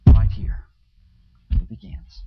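whispered right here. Acted. Pastor whispering to the congregation at the close, hushed. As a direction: breathe it as a whisper to draw them close.

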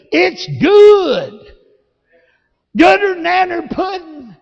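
high, loud and exultant; rising preacher's shout. Real, not acted. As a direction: lift it up, celebrating.

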